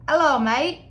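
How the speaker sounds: high, loud attempt at an Australian greeting; botched Australian accent. Vlogger voices the vacationing vulture abroad, botched Australian accent. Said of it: "Oh, that's Australian accent. I'm not good with accents".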